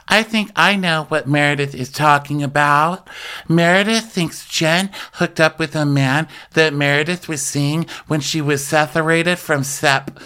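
lightly mocking, measured gossip delivery; Whitney. Podcaster mimics Whitney telling us the gossip, mock knowing.

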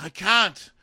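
high, loud mock panic; mock panicky refusal. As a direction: blurt the refusal like a stressed-out lover. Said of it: "He's like".

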